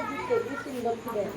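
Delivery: soft low aside off the mic; hushed aside. Real, not acted. Preacher asks quietly for milk for his throat, soft aside. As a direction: drop the voice for a quick aside.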